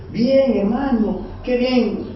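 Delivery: high, drawn-out mock praise; falso elogio. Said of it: "Decir como un elogio". (elogio fingido, con voz aguda y alargada)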